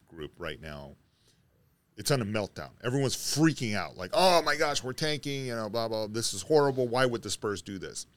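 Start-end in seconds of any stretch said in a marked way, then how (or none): none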